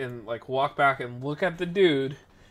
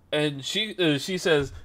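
ordinary talk, nothing marked

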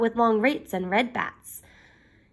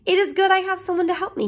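higher and quicker, a small cat piping up; little cat voice; 8 semitones higher, much faster than usual. Acted. Little cat A boasting to the kids, high and quick. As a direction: chirp it fast and high like a tiny cat. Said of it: "little cat A took his hat off".

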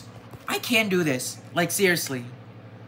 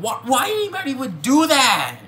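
angry shout; 7 semitones higher than usual. Real, not acted. Vlogger shouting at art thieves, angry outburst. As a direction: throw the question out in outrage. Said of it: "I am very angry right now".

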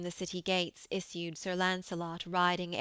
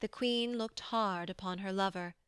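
usual speaking voice

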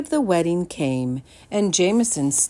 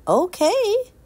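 put-on cheerful boy; 5 dB louder than usual. Jameson agrees.

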